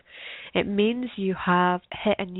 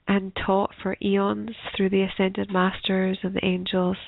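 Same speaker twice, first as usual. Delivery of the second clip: guided meditation. Acted. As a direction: ease them gently into stillness.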